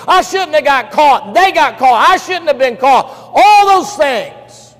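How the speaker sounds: loud, high, accusing wail of grievances; a high, loud complaint that breaks on the last words; bitter outcry. Acted. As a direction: shout out every wrong done to you. Acted bitter list of complaints.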